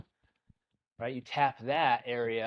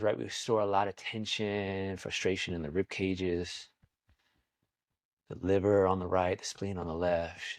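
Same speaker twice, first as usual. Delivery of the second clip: guided meditation; 3 semitones lower than usual. Acted. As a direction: lead them gently into stillness.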